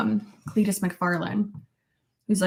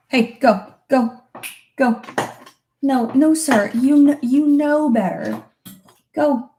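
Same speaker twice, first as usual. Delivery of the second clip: higher, firm commands; stern dog scolding; 5 semitones higher, 5 dB louder than usual. Real, not acted. Owner shooing her dog from trouble, stern scolding. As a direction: order a misbehaving dog away.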